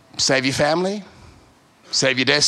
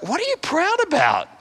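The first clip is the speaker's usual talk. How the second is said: mock incredulous; 12 semitones higher than usual. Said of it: "and I'm like".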